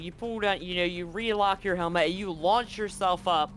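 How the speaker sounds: ordinary delivery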